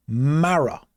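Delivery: "Mara" as MAH-ruh, slow and clear; Mara said clearly. Shown as a demonstration. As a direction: say "Mara" like you're teaching it. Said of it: "Look at the letters".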